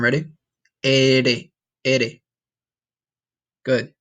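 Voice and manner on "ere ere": "Ere" with a quick tongue tap; rolled R. Shown as a demonstration. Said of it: "let's try it one more time".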